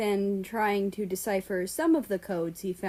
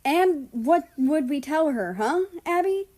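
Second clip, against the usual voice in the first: bitter; 8 semitones higher, 6 dB louder than usual. Acted. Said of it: "Morning laughed bitterly".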